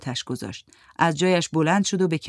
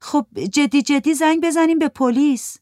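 put-on higher, louder girl's voice; young girl urging; 9 semitones higher, 5 dB louder than usual. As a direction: urge the others to call the police. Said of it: "یکی از دخترها گفت". (صدای دختر جوان، بالاتر و بلندتر)

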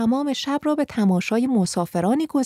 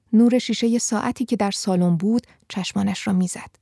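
plain reading voice

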